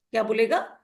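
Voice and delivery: usual speaking voice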